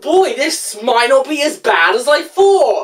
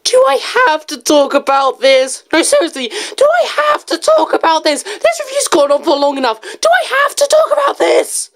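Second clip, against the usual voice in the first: climbing higher with each repeat of the whine; whining protest; 7 semitones higher than usual. Pretend. Reviewer to viewers, begging not to cover it, rising whine. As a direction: beg to be let off, getting shriller. Whining about discussing the characters.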